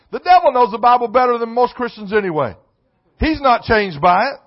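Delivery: loud, high, rising sermon delivery; preaching at full force. Acted. Preacher raising his voice to the church, loud sermon delivery. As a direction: pound each line to the congregation.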